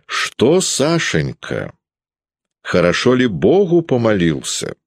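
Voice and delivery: a sly, needling grown-up voice; Peredonov's voice. A narrator's reading. Narrator voicing Peredonov probing Sasha, sly and prying.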